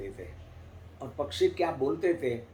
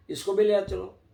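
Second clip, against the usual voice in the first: higher and louder, keenly calling; a villager excitedly asking to take it along; eager request; 7 semitones higher, 6 dB louder than usual. Acted. Villager eagerly asks for stone. (उत्साह से ऊँची और ज़ोरदार आवाज़ में)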